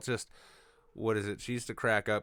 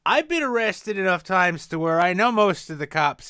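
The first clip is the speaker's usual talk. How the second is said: booming brag; loud, swaggering bravado; loud boast; 8 semitones higher, 9 dB louder than usual. Acted.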